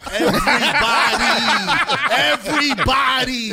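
high, loud, stretched-out storytelling shout; emphatic shout. Retold or imitated. Friend telling podcast hosts about a wild bar brawl, emphatic shout. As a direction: draw it out loud and high through laughter.

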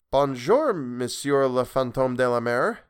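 slow, careful schoolgirl French; girl greeting the sea ghost in careful French; slow clear French. Acted. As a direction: speak French slowly and clearly like a pupil. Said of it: "she said slowly and clearly".

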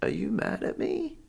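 high, soft, slow mock timid voice; timid questioning. Concierge asking the upset traveller, put-on timid questioning.

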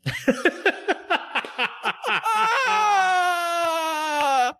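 high, long burst of laughter; big laugh. Real, not acted. Host laughs at his own Will Smith joke, long high laugh. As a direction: crack up at the joke.

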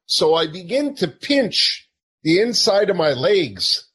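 ordinary talk, nothing marked

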